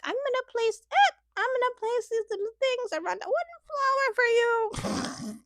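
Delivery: high, sing-song mock of Harry's earnest delivery; mocking Harry's weird delivery. Mocking Harry's weird presentation to Jessica in a high voice. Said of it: "weird delivery".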